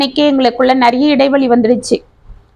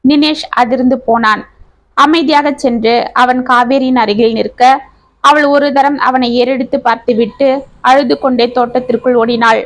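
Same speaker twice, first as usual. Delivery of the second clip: usual speaking voice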